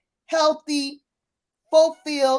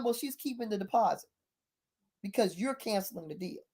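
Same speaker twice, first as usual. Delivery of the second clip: much softer, understated delivery; cool matter-of-fact; 3 semitones lower, 10 dB quieter than usual. Retold or imitated. Neighbor tells buyer the deposit is gone, soft and cool. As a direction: imitate someone quietly laying down terms. Neighbor coolly announces the penalty.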